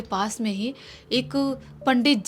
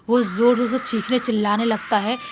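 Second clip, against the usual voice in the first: ordinary delivery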